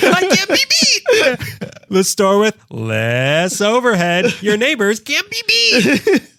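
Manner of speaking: squeaky laughing salesman drawing it out; high-pitched ad voice. Retold or imitated. Mocking commercial slogan again.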